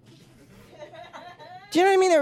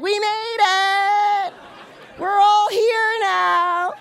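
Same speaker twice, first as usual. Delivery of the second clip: high, very slow savoring voice; triumphant arrival; 6 semitones higher, much slower than usual. Retold or imitated. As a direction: gloat like you finally got in. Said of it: "they go to heaven like".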